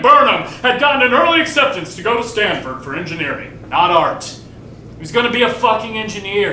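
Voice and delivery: loud, high mocking outburst; bitter ranting. Sibling mocks the family plan for Frank, loud and bitter.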